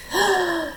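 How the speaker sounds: soft high mock gasp of wonder. Showing the children the night sky, soft gasp. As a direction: gasp quietly as if amazed.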